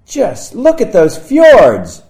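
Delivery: high, loud, slow exclaim; delighted shout. Acted. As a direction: shout it out with drawn-out joy. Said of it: "run up to them on the street and shout".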